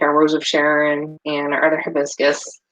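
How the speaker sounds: normal talking voice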